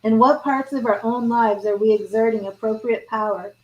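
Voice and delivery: normal talking voice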